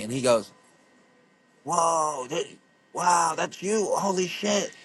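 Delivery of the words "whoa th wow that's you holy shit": amazed. Retold or imitated. Gym guy sees his photo.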